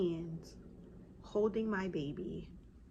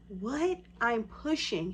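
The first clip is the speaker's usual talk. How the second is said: usual speaking voice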